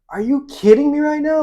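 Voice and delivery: are you kidding me, pitched high and loud; incredulous. Retold or imitated. Stunned that his coworker knows Tara, high and loud disbelief.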